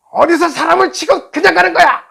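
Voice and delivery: loud, high-pitched mock outburst; angry shouting. Motorbike rider accusing the driver at his window, angry shouting. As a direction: yell it like an enraged rider. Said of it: "막 화를 내요".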